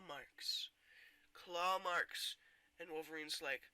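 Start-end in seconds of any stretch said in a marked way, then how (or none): none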